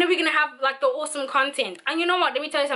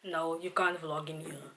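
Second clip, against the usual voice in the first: imitating the old man; 7 semitones lower, 10 dB quieter than usual. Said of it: "And the dude's like".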